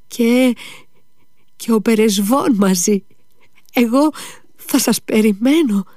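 put-on high child's voice, whispering at moments; a dying boy's last tender requests. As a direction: son asking father to visit his grave, high and tender.

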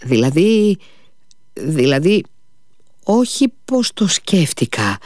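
hesitant, broken-up self-correction; faltering. A narrator's reading. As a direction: stumble over the words, pausing between attempts. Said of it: "είπε κομπιάζοντας ο Αλιώσα".

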